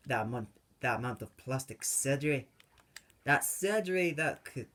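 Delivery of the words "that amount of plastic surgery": ordinary delivery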